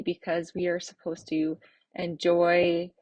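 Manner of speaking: normal talking voice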